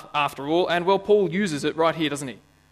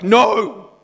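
loud, higher shouted "No!"; emphatic no; 6 semitones higher, 9 dB louder than usual. Retold or imitated. Preacher voices Paul's refusal, a loud emphatic shout. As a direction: bark out a flat refusal. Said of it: "it's like".

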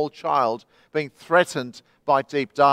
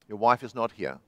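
low, soft, hollow echo voice; awful echo; 8 semitones lower, 6 dB quieter than usual. A narrator's reading. The echo answers the lost man, low and soft. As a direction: answer flat and cold from the dark. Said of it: "that awful echo".

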